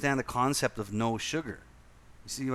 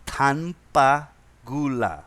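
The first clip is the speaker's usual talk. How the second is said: slow deliberate Indonesian; 5 dB louder, much slower than usual. Retold or imitated. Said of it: "I say to the guy".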